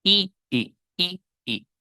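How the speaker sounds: "I, i" drilled as a short lax i; short i repeated. Shown as a demonstration. Teacher drilling the short i for students. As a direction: drill the short "i" sound four times. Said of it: "I, corta".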